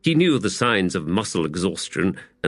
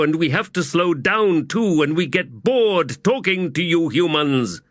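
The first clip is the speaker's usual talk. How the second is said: high, booming giant woman; giant warrior woman; 8 semitones higher than usual. A narrator's reading. Teblor woman's voice.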